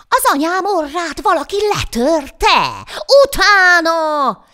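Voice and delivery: loud, high, drawn-out bellow; devil king's furious shout. A narrator's reading. Devil king rallies pursuit of nose-breaker, furious shouting. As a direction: roar the alarm, stretching the final word. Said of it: "kiáltott az ördögök királya".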